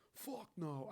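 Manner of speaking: ordinary delivery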